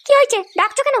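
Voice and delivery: high falsetto woman's voice; put-on wife voice. Wife answering her husband's call in high falsetto.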